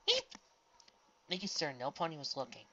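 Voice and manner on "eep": startled squeak. A narrator's reading.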